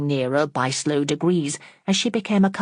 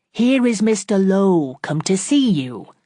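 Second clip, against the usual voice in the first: louder, slow, spelled out as to a deaf person; loud and distinct; 4 semitones higher, 5 dB louder than usual. Acted. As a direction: speak slowly and clearly, as if she can't hear. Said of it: "spoke loudly and distinctly as to a deaf person".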